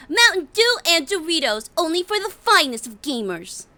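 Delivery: put-on playful gamer girl voice. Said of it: "which she's like".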